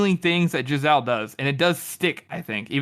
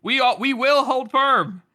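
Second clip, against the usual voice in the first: slow, firm rallying line; rousing speech; 7 semitones higher, 3 dB louder, slower than usual. Retold or imitated. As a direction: stand firm before the crowd.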